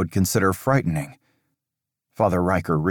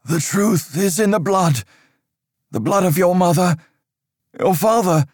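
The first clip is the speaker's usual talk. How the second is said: a higher, louder stern priest's voice, measured and grave; stern old priest; 12 semitones higher, 3 dB louder than usual. Acted. Father Riker warning Aldous of dark times, stern and raised. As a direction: lecture the boy on dark times like an anxious cleric.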